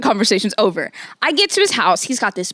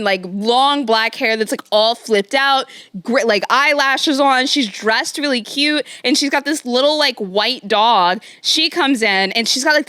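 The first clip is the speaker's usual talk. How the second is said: ordinary delivery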